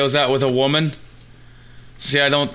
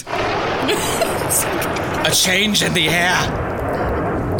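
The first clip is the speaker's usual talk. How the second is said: dramatic narrator; 6 semitones higher, much slower than usual. Acted. Dramatic build-up in silly story.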